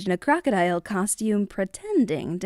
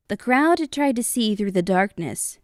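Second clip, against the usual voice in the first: ordinary delivery, nothing marked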